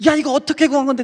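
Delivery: high, loud outraged scolding; a friend furious at the spilled powder; angry friend. Retold or imitated. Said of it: "그때 욕을 막 하면서".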